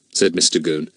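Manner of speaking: plain reading voice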